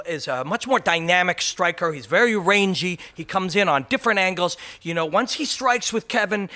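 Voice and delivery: hammed-up fight pundit addressing the camera; Rashad. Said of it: "he'll take his turn. He'll turn to the camera".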